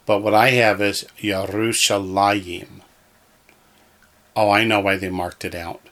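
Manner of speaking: ordinary talk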